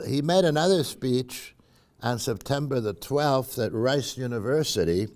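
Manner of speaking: normal talking voice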